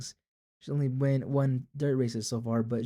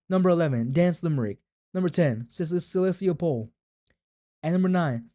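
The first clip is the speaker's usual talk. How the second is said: raised, projected commentary; race announcer; 4 semitones higher, 5 dB louder than usual. Acted. Announcer listing runners at the gate, projected. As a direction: call out the runners for the crowd.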